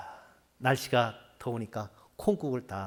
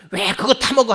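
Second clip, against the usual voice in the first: judging scold; 7 semitones higher, 12 dB louder than usual. Shown as a demonstration. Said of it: "하면서 정죄하게 되면은".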